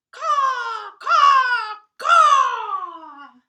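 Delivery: very loud, high crow caws; cawing crows. Acted. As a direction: shriek like a crow. Crows return.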